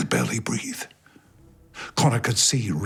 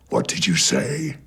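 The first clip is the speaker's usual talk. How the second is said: monster voice. Acted. Tree monster surprised by the boy's dare. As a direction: rumble the question, taken aback. Monster asks, taken aback.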